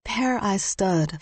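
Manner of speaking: ordinary delivery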